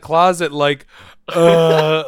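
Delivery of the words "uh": loud mock groan of dying. Patient sitting in the closet after surgery, mock dying groan. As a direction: groan loudly as if dying, hammed-up. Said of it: "like, dying".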